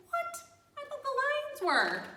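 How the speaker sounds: high disbelieving squeak; shocked what. Pretend. Reader voicing Edward's surprise at the snakes, high and shocked. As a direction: leap up in surprise at the news. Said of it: "It's like".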